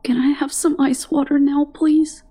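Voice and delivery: pitiful plea. A narrator's reading. Sick girl pleading with Stanley for water, pitiful. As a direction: beg like a small child. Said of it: "met his gaze pitifully".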